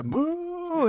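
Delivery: high, drawn-out imitated boo; mock crowd boo. Comic imitating the hostile crowd booing him again, mocking.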